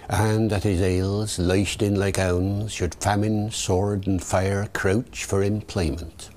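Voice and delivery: slow Shakespearean English accent, rhotic R; slow Elizabethan original pronunciation reading. Shown as a demonstration. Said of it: "David the O P".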